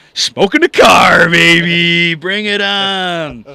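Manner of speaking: loud, slow hyped-up shout; hype shout. Acted. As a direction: yell it like a hype man.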